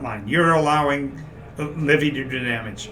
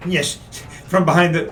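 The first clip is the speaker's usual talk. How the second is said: ordinary delivery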